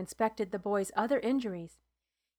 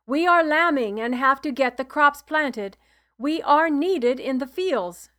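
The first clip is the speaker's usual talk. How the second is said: 1 insistent protest; 4 semitones higher, 8 dB louder than usual. A narrator's reading. Father protesting to healer.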